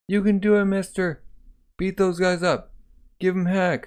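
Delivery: put-on root for the hero like an excited kid; cheering kid. Kids cheer on Thorfinn.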